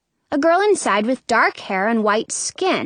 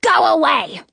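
higher, restrained yell; 6 semitones higher, 4 dB louder than usual. A narrator's reading. Old lady wrenching the basket away, mildly yelling. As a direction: order them off sharply. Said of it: "and yells".

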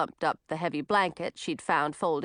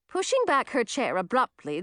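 ordinary delivery, nothing marked

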